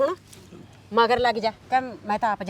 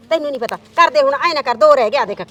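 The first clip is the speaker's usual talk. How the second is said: pushy haggling; 8 dB louder, faster than usual. Acted. Aunt haggling for more money.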